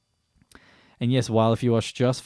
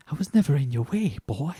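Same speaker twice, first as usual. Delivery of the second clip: a lightly voiced father, pitched higher; father's line; 5 semitones higher than usual. A father reaching out to his son before he leaves, lightly voiced. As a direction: give the father's half-hearted reach a gentle voice. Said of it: "He says something like".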